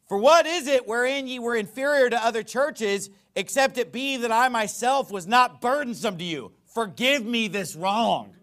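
loud, high sarcastic declamation. Acted. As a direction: proclaim it with biting sarcasm. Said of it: "Now, that is sarcasm".

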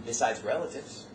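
normal talking voice

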